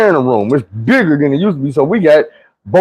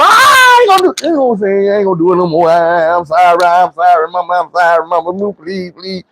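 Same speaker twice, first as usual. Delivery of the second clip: mock crying plea; 3 semitones higher than usual. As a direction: ham up a whining kid pleading for mercy. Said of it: "I'm hooping and hollering".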